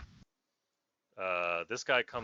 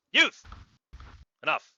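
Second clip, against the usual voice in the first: commanding woman; 4 dB louder than usual. Acted. Woman stops the guard.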